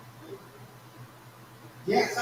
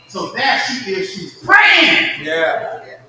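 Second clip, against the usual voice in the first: fervent preaching; 9 dB louder than usual. Real, not acted. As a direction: drive home the mother's prayer, loud. Preaching climax about praying mother.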